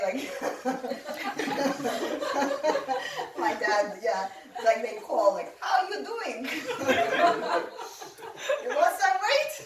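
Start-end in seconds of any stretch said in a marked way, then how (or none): 5.6-9.5 laughing. Retold or imitated. Teasing the owner, laughing.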